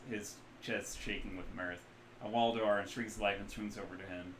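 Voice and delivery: normal talking voice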